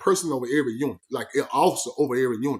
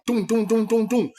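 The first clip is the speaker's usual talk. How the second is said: thump out the knocks on the cell door; knocking on door; 5 semitones higher than usual. Retold or imitated.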